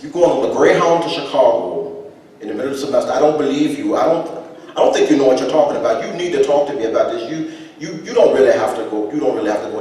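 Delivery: loud, high, fast demanding father; indignant grandfather. Retold or imitated. Grandfather scolding his son, loud and high. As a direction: push back hard at the son. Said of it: "father voiced in story".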